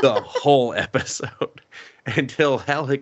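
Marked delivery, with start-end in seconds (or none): 0.0-1.6 higher, laughing stress on "whole"; amused emphasis. Real, not acted. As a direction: repeat it through a laugh, leaning on "whole". Amused repeat of the phrase.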